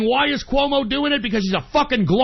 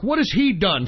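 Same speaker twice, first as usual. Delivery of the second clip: ordinary talk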